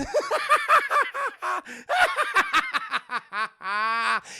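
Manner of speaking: high, loud maniacal cackle; wild staccato villain laugh; Joker cackle. Acted. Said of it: "all we hear is".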